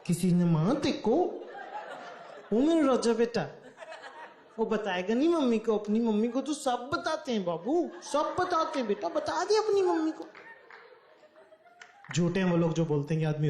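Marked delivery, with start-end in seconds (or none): 0.1-10.2 put-on slow, higher coaxing baby talk; mother coaxing her hurt son to tell her, slow and higher; mommy tone. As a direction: coax him like a doting mother. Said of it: "मम्मियों वाली tone use करना".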